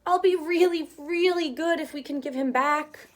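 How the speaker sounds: put-on a touch of child begging; lightly high, promising to be good; lightly voiced pleading child. Chester promises his mother, lightly pleading child voice.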